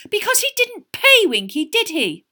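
very high, loud, shrill outrage; rising shrilly. A narrator's reading. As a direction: let indignation push the voice right up. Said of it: "her voice rising shrilly".

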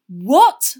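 loud, high, appalled question; horror-struck. A narrator's reading. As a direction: blurt it out in disbelief. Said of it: "looking horror-struck".

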